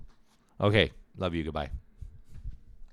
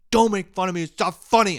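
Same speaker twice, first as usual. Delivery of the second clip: high, loud indignant yell; re-enacted outburst, much higher and louder; pissed off; 14 semitones higher, 12 dB louder than usual. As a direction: yell it like a wounded, furious tantrum. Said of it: "really pissed".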